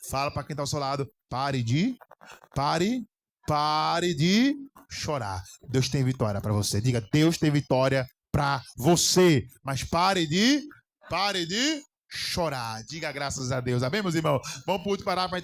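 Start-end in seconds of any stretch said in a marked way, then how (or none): 1.3-5.4 slow, drawn-out pare de chorar, each word hit and louder; emphatic slow command. Real, not acted. Pastor pleading slowly with believers to stop crying. 9.6-12.8 slow, drawn-out pare de chorar, each word hit and louder; emphatic slow command. Real, not acted. Pastor pleading slowly with believers to stop crying.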